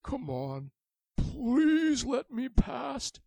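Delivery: high, slow desperate plea; pleading dragon. A narrator's reading. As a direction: beg to be let past. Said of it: "He said".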